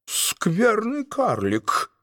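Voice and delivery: slow, high but soft angry cry; angry outburst. A narrator's reading. Duke scolding the dwarf cook, high slow angry cry. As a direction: spit the insult out slowly. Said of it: "закричал он".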